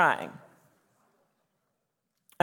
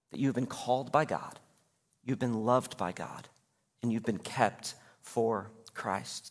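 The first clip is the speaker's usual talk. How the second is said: soft tender affirmation; 4 semitones lower, 7 dB quieter than usual. Shown as a demonstration. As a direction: lower and gentle, let each phrase land softly. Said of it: "repeated affirmation softly".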